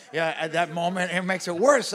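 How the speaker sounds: usual speaking voice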